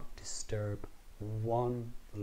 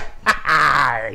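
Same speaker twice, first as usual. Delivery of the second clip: triumphant laugh; 5 semitones higher, 18 dB louder than usual. Acted. Triumphant grab.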